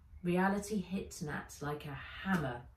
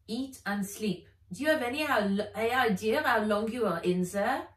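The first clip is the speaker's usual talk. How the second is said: louder, insistent Philippe voice; firm Frenchman; 5 semitones higher, 5 dB louder than usual. Acted. Philippe insisting Nat rest, louder. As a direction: gently insist she rests.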